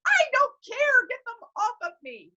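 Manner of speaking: high frightened outburst; panicked plea. Retold or imitated. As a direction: beg desperately to get them off. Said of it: "And I'm like".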